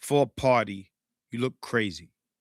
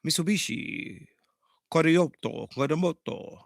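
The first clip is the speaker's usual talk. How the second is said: softer fake-Japanese word list; mock Japanese; 4 dB quieter than usual. Shown as a demonstration. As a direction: imitate Japanese, one word at a time. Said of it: "That's how Japanese sounds".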